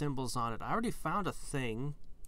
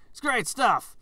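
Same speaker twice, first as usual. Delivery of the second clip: loud, high burst of enthusiasm; excited; 10 semitones higher, 10 dB louder than usual. Real, not acted. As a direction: gush it out loudly. Bursts out enthusing about football.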